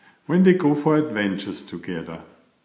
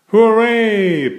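cheering; 10 semitones higher, 7 dB louder than usual. A narrator's reading. Narrator cheers the fixed glasses.

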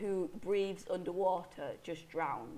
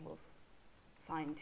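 ordinary talk, nothing marked

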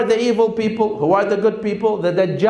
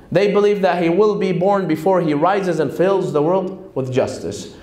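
usual speaking voice

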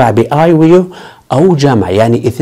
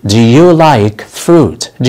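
slow model sentence; much slower than usual. Shown as a demonstration. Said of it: "هل تحب الفواكه؟".

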